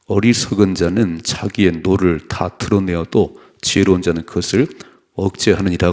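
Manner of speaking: loud, steady unison reading of the verse; scripture read aloud. Real, not acted. Pastor leads the congregation in reading, loud and steady. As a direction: read the proverb out together, loud and even.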